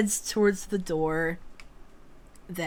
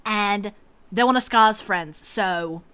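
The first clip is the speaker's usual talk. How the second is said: fast, louder huffy explanation; huffy and hurried; 4 semitones higher, 7 dB louder, faster than usual. Acted. Grian huffily tells the man they're leaving now. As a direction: rush through it impatiently. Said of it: "Grian just huffs and says".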